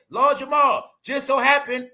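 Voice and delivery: ordinary delivery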